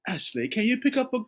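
high sing-song request to Siri; lilting dictation voice; dictating a text to Siri, lightly mock. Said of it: "Is it".

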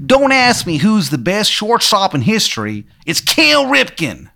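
put-on high, terrible Maryland accent; Shithead Steve's Maryland accent. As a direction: proclaim it in a hammed-up Maryland twang. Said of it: "he's got, like, a terrible Maryland accent".

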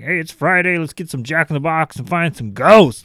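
a breezy, mocking imitation of a careless ghost hunter. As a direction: parody the eager ghost hunter's plan.

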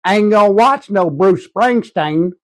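mock a bigoted yokel. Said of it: "making comments about how they".

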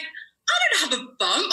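high, slow, disbelieving denial. Retold or imitated. Denying the bump to the doctor, high and slow. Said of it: "I was like".

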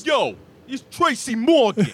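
high, very loud, drawn-out comic shout; Tracy Morgan impression. Host mimicking the comedian, loud slow shout. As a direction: blast out a celebrity parody. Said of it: "going more for Tracy Morgan".

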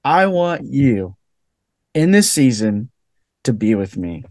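gently, like a loving father; hushed tender divine answer; tender voice of God. Retold or imitated. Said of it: "he's like".

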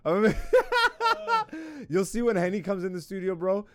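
high, slow laughing talk. Real, not acted. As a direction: laugh through it. Laughing while talking.

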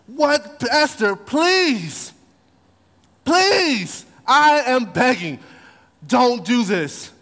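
high, slow desperate begging; pleading Haman. Retold or imitated. Haman begging the queen for mercy, slow and desperate. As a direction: drag out each please in desperation.

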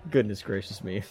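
ordinary talk

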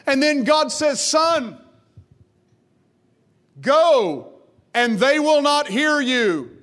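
high, loud preaching, voicing God and Isaiah; full-force preaching. Preacher to congregation, retelling Isaiah's call, high and loud. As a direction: pour it out to the congregation at the top of the voice.

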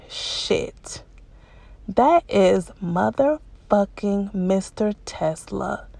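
hushed, stunned curse under the breath; shocked whisper. Retold or imitated. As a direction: breathe it out in disbelief, slowly. Recognizes the man, shocked whisper.

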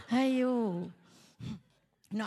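usual speaking voice

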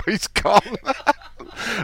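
slow words through high laughter; real giggling fit after hanging up; laughing. Real, not acted. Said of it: "I couldn't help laugh".

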